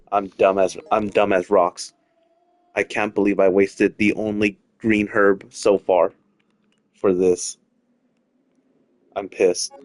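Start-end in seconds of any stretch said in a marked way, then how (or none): none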